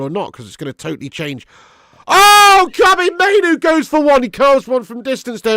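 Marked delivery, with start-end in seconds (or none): 2.1-5.6 much higher and louder, slowed rising call; excited commentary. Real, not acted. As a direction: call the shot like a hyped commentator. Excited live match commentary.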